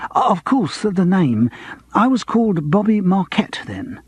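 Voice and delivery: a hint of a fussy old pianist pressing; eager old pianist jogging memory; eager actor. A narrator's reading. Norman explains name.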